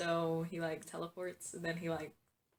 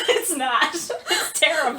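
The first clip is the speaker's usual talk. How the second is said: laughing tease; 7 semitones higher, 7 dB louder, slower than usual. Real, not acted. Teasing friend's accent.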